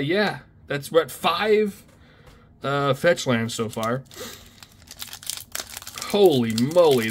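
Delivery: normal talking voice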